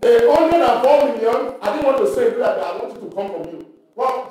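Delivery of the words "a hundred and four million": loud called-out answer; loud reply from the room; calling out. Real, not acted. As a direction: shout the figure to the preacher. Congregation calls out answer.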